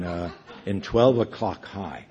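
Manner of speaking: ordinary talk, nothing marked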